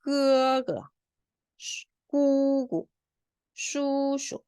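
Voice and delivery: 끄끄, 꾸꾸, 슈슈 high, then short and weak; neutral tone ending. Shown as a demonstration. Teacher reading doubled words for students, weak short endings on 끄끄, 꾸꾸, 슈슈. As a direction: hold each first syllable high, clip the second lightly in 끄끄, 꾸꾸, 슈슈. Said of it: "경성은 약하게 짧게 읽었죠".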